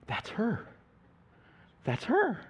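imitating someone stunned; stunned recognition. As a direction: retell it as a shocked, half-voiced realisation. Stunned evangelist recognises the woman.

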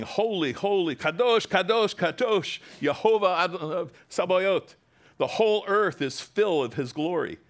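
high, loud ringing proclamation; angels crying holy. Retold or imitated. Seraphim crying to one another, loud and high. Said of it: "one cried to another".

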